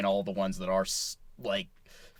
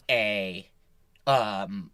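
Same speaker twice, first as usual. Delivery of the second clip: usual speaking voice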